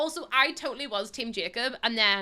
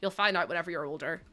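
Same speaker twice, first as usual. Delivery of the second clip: hushed reply; 6 semitones lower, 6 dB quieter than usual. Retold or imitated. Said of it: "I'll be like".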